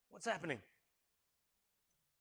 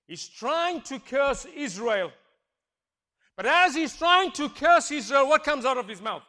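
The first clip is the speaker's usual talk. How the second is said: slow, loud, higher build; preaching shout; 8 semitones higher, 20 dB louder than usual. Real, not acted. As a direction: drive it home from the pulpit.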